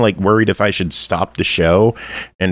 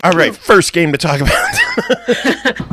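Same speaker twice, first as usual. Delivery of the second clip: laughing; 13 semitones higher, slower than usual. Acted. Host mock starts the reviews.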